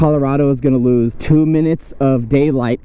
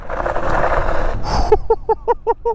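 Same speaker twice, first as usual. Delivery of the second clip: airy high laugh; breathy laugh. Real, not acted. Laughing while riding.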